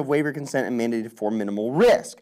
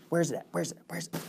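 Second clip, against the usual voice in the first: fading mutter; 10 dB quieter, faster than usual. Real, not acted. Searching for a study, fading.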